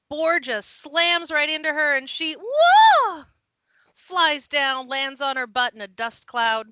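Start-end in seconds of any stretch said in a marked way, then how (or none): 2.3-3.2 loud, high, drawn-out "whoa"; startled whoop. Acted. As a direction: yell as if knocked off your feet. Acts woman being knocked flying.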